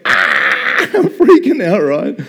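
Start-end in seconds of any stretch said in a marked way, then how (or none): 0.0-0.8 a sudden, loud inner yelp of panic; inner scream. Retold or imitated. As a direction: cry out in half-mock terror. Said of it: "But internally, I'm like".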